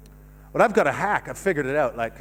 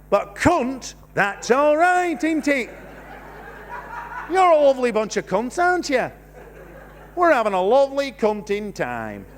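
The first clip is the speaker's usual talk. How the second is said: higher-pitched, put-on northern accent; 6 semitones higher than usual. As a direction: relish it in a generic northern accent. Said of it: "the only voice you're allowed to do it in".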